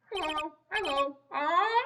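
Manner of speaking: high, slow parrot squawk; shrieking pet parrot. Acted. Parrot voiced.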